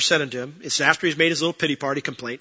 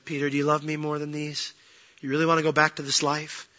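level, softened questioning; gentle; 3 dB quieter than usual. Acted. Jesus asking Peter after cooking him dinner, gently. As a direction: ask tenderly, without reproach. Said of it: "and just gently says to him".

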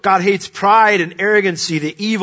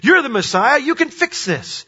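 angry demand; 10 semitones higher, 4 dB louder than usual. Retold or imitated. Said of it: "he's angry because he's like".

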